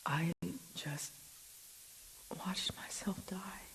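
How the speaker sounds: slow, stunned whisper; shaken whisper. Acted. Officer who just saw her own death, slow shocked whisper. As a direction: breathe it out in shock.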